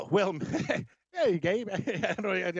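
real laughter breaking up the words; laughing. Real, not acted. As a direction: laugh helplessly through a slow, halting start. Laughs at Scottish accent request.

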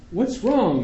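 high alarmed cry, lightly acted; a touch of a worried mother; mother cries out. A narrator's reading. Mother cries out to the doctor in alarm. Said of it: "my mother cries".

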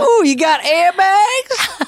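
mocking yokel voice. Mocking city boy's Volvo.

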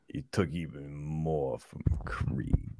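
slow, hoarse near-whisper; drawn-out whispered lament; hushed grievance. Acted. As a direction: let it sink to a slow, wounded hush. Aggrieved star lamenting lost money.

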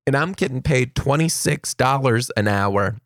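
ordinary talk

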